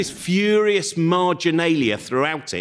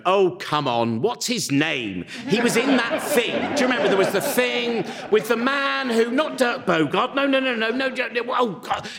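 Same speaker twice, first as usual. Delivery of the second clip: higher, exasperated searching voice; dad straining to remember; 7 semitones higher than usual. Retold or imitated. Dad watching old films, exasperated, straining to recall. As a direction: struggle aloud for the actor's name. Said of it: "with him going".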